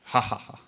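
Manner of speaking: mock laugh. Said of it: "bursting from laughter".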